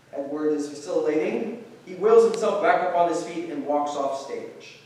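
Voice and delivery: ordinary delivery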